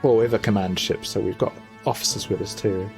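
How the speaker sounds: ordinary delivery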